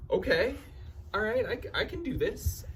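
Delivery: high-pitched, acted-out nervous pep talk; nervous self-reassurance. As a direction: talk yourself into it in a high, uneasy voice. Nervously psyching himself up in dark.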